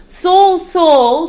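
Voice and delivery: loud, high call of Saul's name; divine call. Acted. Jesus calling down to Saul on the road. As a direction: call out from heaven, ringing.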